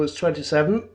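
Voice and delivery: usual speaking voice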